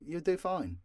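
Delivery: ordinary talk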